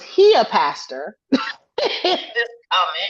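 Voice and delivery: slow, laughing through the words; can't help laughing at the absurdity. Real, not acted. Laughing while recounting pastor comment.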